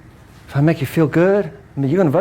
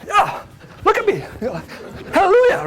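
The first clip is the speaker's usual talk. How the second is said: very high, laughing Hallelujah; hammed-up healed churchgoer; mock joyful believer. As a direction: play the cured believer overjoyed.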